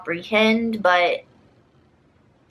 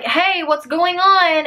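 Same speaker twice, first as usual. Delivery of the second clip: high, drawn-out query; raised pitch, stretched words; concerned calling out; 9 semitones higher, 4 dB louder, slower than usual. Retold or imitated. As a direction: imitate a friend calling out over video chat. Acts out friend calling over FaceTime.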